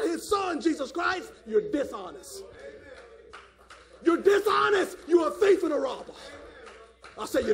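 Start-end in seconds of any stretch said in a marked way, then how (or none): 4.0-6.1 high, loud preacher's cry; ringing pulpit accusation; shouted accusation. Real, not acted. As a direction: shout the charge at the congregation.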